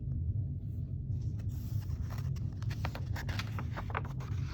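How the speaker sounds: a long, quiet, breathy gasp; whispered gasp of alarm; hushed gasp. Acted. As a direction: gasp softly in suspense. Suspense gasp to listeners.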